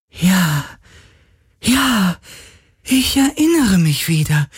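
hohe, staunende Geisterstimme; erinnernder Geist. Acted. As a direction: langsam wieder erkennen. (hohe Geisterstimme, sich erinnernd)

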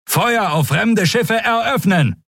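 high, slow commanding shout; shouted order. Acted. Admiral orders the gunners to fire, shouting. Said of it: "rief er".

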